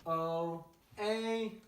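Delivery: voice crack. Real, not acted. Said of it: "Sorry, voice crack".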